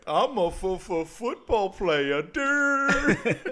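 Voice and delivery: loud, high, drawn-out stammering taunt; mocking football player. Guy mocks the new football player, high drawn-out taunt. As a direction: stretch out a loud, high mock stutter. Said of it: "he does the football player thing".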